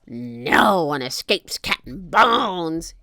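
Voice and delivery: slow, loud pirate roar; drawn-out villain's boast; Captain Bones. A narrator's reading. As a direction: roar it slowly as a menacing pirate. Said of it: "he roared".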